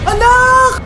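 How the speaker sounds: put-on loud high wail for her child; anguished cry. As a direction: cry out in grief for a dead child. Mother sees child dead. (pasigaw na pagtangis sa anak)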